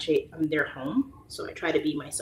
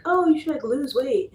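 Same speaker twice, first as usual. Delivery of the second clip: high, mock preachy advice; mocking critics; 8 semitones higher, 4 dB louder than usual. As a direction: imitate nosy people lecturing. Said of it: "people are always like".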